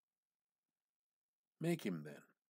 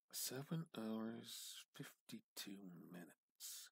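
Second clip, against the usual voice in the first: slow dawning murmur; 8 dB quieter, slower than usual. A narrator's reading. Repeating the figure in dread.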